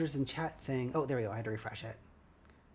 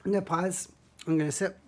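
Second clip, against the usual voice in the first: dry throat; 5 semitones higher, 4 dB louder than usual. Real, not acted. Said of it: "My throat is a little bit dry".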